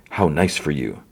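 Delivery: low, understated quote of a character; retold cool remark. Luthen answering Mon at the wedding, retold with restraint. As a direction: quote it evenly, falling at the end. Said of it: "And then Luthen's like".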